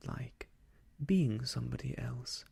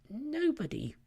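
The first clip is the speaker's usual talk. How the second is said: Rabbit voice; 12 semitones higher, 4 dB louder than usual. Acted. Rabbit pretends nobody is home.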